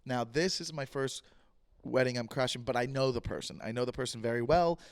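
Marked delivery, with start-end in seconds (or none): none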